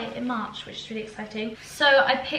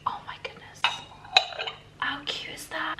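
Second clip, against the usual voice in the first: awed whisper; 6 semitones higher, 6 dB quieter than usual. Real, not acted. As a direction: whisper in delight, almost speechless. Gushing over a mini pot.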